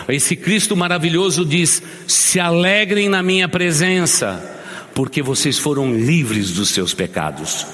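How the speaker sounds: high, ringing sermon climax; full-force preaching. Acted. Pastor rallies the brothers to answer, full-force preaching. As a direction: lift the crowd toward the answer.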